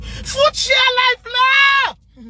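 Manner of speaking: very slow, high, loud wail; crying shout. Real, not acted. Overcome while recalling meeting him, a sobbing shout. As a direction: stretch it out in a sobbing cry.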